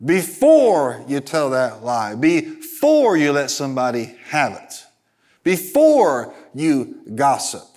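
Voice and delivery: slow, hammered "before" to open each warning; stress on BEFORE. Real, not acted. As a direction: hit the second syllable of "before", stretching the phrase. Preacher hammers repeated word before.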